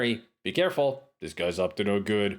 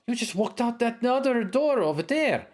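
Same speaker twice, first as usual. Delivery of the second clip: high, sharp woman's voice; woman cleric voice; 11 semitones higher than usual. Acted. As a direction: voice the cleric scolding him. Cleric talks to the agent.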